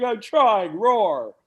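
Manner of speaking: a loud, half-laughing fake lion roar; mock roar. To the photographer, a loud mock lion roar. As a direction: belt out a feeble roar as if playing tough. Model told to act like a lion.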